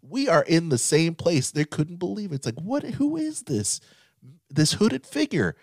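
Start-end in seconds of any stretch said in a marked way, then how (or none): 2.6-5.6 very high, slow amazed question; stretched-out, high-pitched wonder; astonished disbelief. Retold or imitated. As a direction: squeal it in mock disbelief. Acting out disbelief at the screen.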